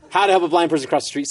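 fast read. Shown as a demonstration. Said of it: "reads the question again".

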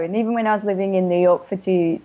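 normal talking voice

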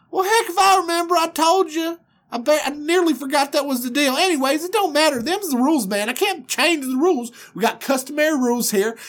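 fast, high backwoods patter. Acted. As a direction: brush him off in a rapid Southern twang. Voices Laban brushing him off.

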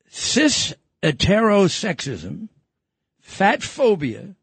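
slow, drawn-out mock reading; slow mocking emphasis. Host reading Harvard's offense list slowly and mockingly. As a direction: spell out each term slowly with scorn.

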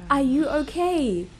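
imitating her own incredulous comeback; incredulous retort. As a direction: stretch it out as an appalled question. Retorting in retold quarrel, incredulous.

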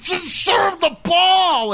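high, slow, drawn-out mock yell; impatient yell. Rival kept waiting, yelling high and drawn out at the server. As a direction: demand the serve like a fed-up opponent. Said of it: "they're just like".

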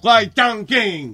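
slow, drawn-out mock Chinese "Huay Chang King"; mock Chinese name.

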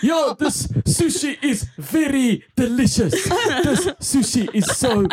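hammed-up thick Afrikaans accent, pitched high and laughing. Friends at a table one-upping each other's accents, in laughing, exaggerated Afrikaans. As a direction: play it up with a heavy Afrikaans lilt, giggling through it. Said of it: "sound like apartheid leaders".

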